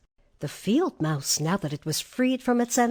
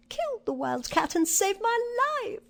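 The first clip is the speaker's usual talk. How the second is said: high-pitched royal mouse; squeaky mouse queen; 12 semitones higher than usual. A narrator's reading. Mouse queen orders her mice to serve him, high squeaky voice. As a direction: give orders in a tiny squeak. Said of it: "she answered".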